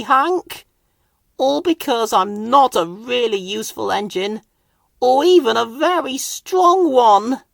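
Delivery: small engine blaming himself, close to tears; despairing Thomas. A narrator's reading. Thomas tells Hank it's all his fault, tearfully.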